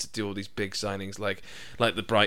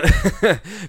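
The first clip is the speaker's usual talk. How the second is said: burst of laughter; 10 semitones higher, 8 dB louder than usual. Real, not acted.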